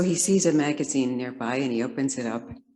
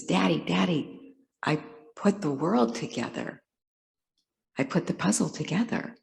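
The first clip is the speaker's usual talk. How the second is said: lightly voiced eager child, a bit higher; excited little boy; 4 semitones higher than usual. Boy comes back to his father with the finished puzzle, mildly excited. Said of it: "very excited. And he says".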